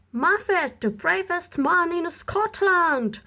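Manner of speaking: proud crowd shouting his praise; excited cheering. Acted. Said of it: "they cried".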